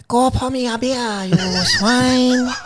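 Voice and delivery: high, drawn-out mock Japanese accent. Shown as a demonstration. Co-host takes the accent challenge, in a high Japanese accent. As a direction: stretch it out in a put-on Japanese accent. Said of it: "but say it in Japanese".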